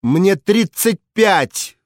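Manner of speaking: high, slow indignant cry; angry cry. A narrator's reading. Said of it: "вскричала рассерженная женщина". (рассерженный возглас)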